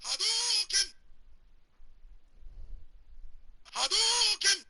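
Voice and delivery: very high shouted fireball call; Street Fighter Ryu. Retold or imitated. Streamer imitating the Street Fighter move call, shouting. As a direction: yell it like Ryu throwing a fireball. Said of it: "Street Fighter".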